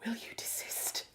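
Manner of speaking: hissed, very soft reprimand; whispered scolding. Real, not acted. Owner to squeaking dog mid-stream, whispered scolding.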